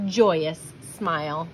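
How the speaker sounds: usual speaking voice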